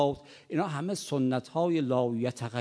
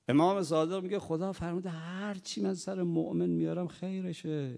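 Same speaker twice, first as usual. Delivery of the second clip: usual speaking voice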